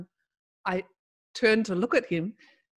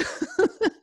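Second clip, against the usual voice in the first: real high laugh. Real, not acted. Laughing at funeral memory.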